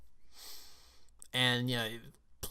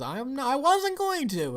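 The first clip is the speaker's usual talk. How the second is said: high-pitched, louder mock protest; Astrid; 14 semitones higher, 6 dB louder than usual. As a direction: voice her innocent denial, pitched way up. Voices Astrid answering Hiccup's protest.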